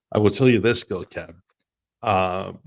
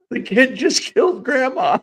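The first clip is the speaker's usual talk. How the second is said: high, loud comic holler; retold yell; 7 dB louder than usual. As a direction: yell it out, on the edge of laughter. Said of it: "I yell out".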